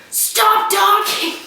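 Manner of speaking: put-on high, loud, drawn-out kid shout; child's yell. Three-year-old at bath time feeling left out, imitated loud yell. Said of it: "he just says".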